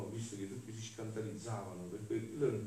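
usual speaking voice

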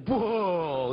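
loud, hammed-up whoa; mock astonishment. Luke watching the speaker explode, loud mock astonishment. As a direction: blurt it out in fake shock. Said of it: "Luke will be like".

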